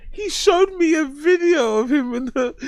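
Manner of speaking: high squeal through real laughter; helpless laughter. Real, not acted. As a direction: quote it while cracking up, voice shooting up. Laughing at the clip's confession.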